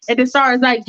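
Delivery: ordinary delivery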